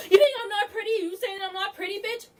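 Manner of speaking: high-pitched, hammed-up screech; mock screaming. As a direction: imitate a rival shrieking in outrage. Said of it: "fucking scream at people".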